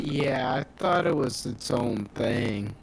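glitchy robotic connection; distorted robot voice over a bad line. Real, not acted. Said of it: "you were doing that robot voice shit again".

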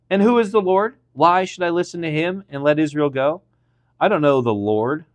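high, challenging questions from a ruler; defiant retort. A narrator's reading. Pharaoh refuses the demand to free Israel, defiant retort. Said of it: "retorted Pharaoh".

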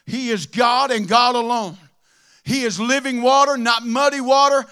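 loud, fervent preaching. Acted. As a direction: thunder it from the pulpit. Preaching at full force.

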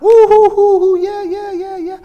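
high mock whooping that fades off; mock cheering. Mimicking a congregation that cheers but does nothing, mock cheering. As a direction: whoop like an overdone crowd, then let it die away.

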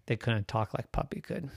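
ordinary delivery, nothing marked

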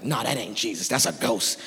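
low, soft dismissive doubter; Judas. Acted. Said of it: "Judas is in the back like".

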